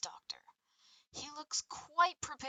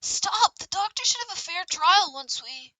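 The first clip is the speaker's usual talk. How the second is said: loud, high commanding shout; 9 semitones higher, 16 dB louder than usual. Acted. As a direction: yell it to halt them. Character shouts to stop.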